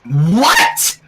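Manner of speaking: loud shocked what; roared. A narrator's reading. Rainbow Dash hearing the card, roaring. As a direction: roar it out in disbelief. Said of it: "Rainbow Dash roared".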